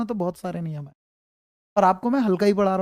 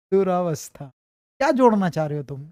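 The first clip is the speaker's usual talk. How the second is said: ordinary talk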